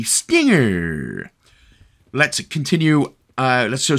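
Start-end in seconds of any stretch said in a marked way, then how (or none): 0.0-1.3 slow, drawn-out showy weapon reveal; hammed-up announcement. Host revealing the weapon to grab, hammed-up announcement. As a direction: announce it grandly, stretching the last word.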